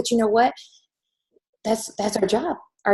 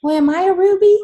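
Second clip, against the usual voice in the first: put-on child voice; 10 semitones higher, 5 dB louder than usual. Daughter asks, voiced high.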